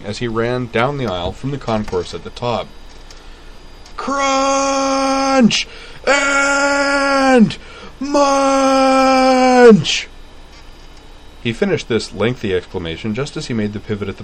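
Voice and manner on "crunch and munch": very high drawn-out scream; screaming vendor. Acted. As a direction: scream it down the aisle.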